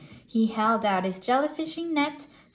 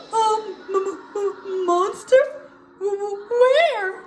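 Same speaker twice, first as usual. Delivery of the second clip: high, stammered questions; nervous; 9 semitones higher, 5 dB louder than usual. Acted. Patrick asks his friend about the monster, nervous stammer. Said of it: "Patrick asked nervously".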